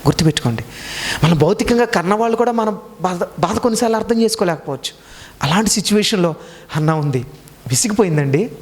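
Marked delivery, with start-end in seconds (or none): none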